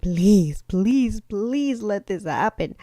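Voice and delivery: mock pleading, slowed on the last please; begging tone. Shown as a demonstration. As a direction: beg the universe, drawn out. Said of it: "in a begging tone".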